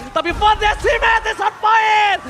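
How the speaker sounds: hype shout. Real, not acted. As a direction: bellow the catchphrase. Caster catchphrase shout.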